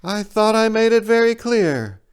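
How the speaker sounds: a hint of a bird voice laying down the rule; bird stating flatly. Big bird coldly reminding the narrator, lightly voiced. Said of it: "the big bird stated flatly".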